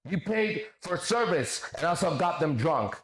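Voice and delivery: slow, high mocking quote; mocking read-out. Streamer reading out an opponent's accusation, mockingly.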